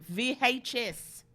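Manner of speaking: slow put-on Australian accent. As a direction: spell it out in mock Australian. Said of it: "an Australian accent when they s".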